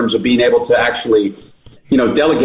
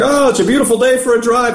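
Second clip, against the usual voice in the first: higher, unbothered cheer; breezy carefree; 9 semitones higher than usual. Retold or imitated. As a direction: toss it off like nothing happened. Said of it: "So he's like".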